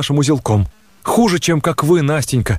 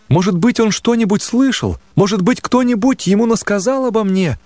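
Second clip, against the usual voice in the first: higher-voiced, pleading, indignant girl; a hurt young woman demanding answers, voice raised in pitch; agitated young woman; 6 semitones higher than usual. Nastenka's agitated outburst.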